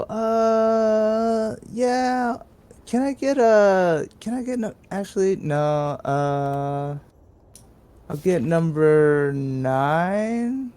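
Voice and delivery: high-pitched put-on voice, drawn-out dithering; obnoxious indecisive customer. Prank customer dithering at the drive-thru menu, obnoxiously high and drawn out. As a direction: stretch the words out high and slow, stalling. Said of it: "obnoxious and, like, changing my order around".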